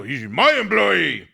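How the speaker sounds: slow, mock-pompous outburst; pompous mimicry. Host mimicking pompous Joe, self-important put-on voice. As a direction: puff up "my employee" in a put-on voice. Mocks Joe's pompous claim.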